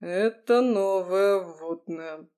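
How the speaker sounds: slow, flat deadpan delivery; emotionless. Acted. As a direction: say it with zero emotion. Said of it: "безэмоционально сказал Артём Витальевич".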